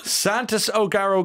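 normal talking voice

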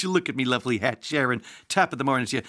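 bad stage-Irish accent; mock a hammy film-star Irish brogue; Tom Cruise. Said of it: "Tom Cruise in Far and Away".

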